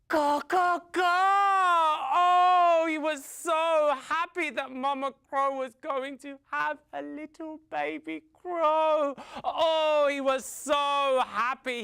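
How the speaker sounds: high, loud, elated caws and gushing; overjoyed. A narrator's reading. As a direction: burst with joy at the baby news. Father crow overjoyed at baby news.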